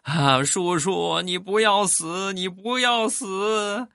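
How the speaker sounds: put-on high, slow wailing little boy; crying child. Little nephew crying over his uncle, high slow wail. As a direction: wail it out like a frightened kid. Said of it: "抱着我大声的就哭".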